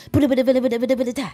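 high, rapid-fire rhythmic "bada, bada" syllables; double-time grime flow on "bada, bada"; rapid spit flow. Shown as a demonstration. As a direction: rattle "bada, bada" like a fast grime MC. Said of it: "hit it with the spit flows".

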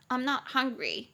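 ordinary talk, nothing marked

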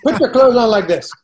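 mock nagging Indian parent; bossy Indian parent. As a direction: boss the kid through every chore. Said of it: "it's very Indian culture to be like".

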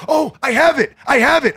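high, loud excited blurting; bursting out with relief; eager relief. Retold or imitated. Guest retells answering the supposed owner, high loud excited relief.

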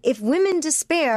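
rallying herself off the floor; stern self pep talk. Retold or imitated.

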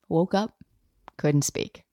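ordinary talk

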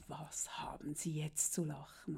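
a soft, slow, whispered mock question; imitated whisper. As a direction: whisper it slowly like the sour clerk. Beamter fragt säuerlich, nachgespielt. (leise und langsam geflüsterter Beamter)

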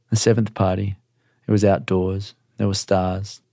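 ordinary delivery, nothing marked

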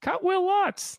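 high, loud, exaggerated sarcastic shout; sarcasm voice. Host joking about cutting the kicker, mock sarcasm voice. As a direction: put on a squeaky, over-the-top sarcasm voice. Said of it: "I wish there was a sarcasm voice I could make".

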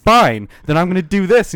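very loud, high mock threat; imitated angry escalation. Fed-up stranger retaliating on train, mock angry outburst. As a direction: mimic someone fed up and escalating.

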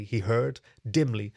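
ordinary delivery

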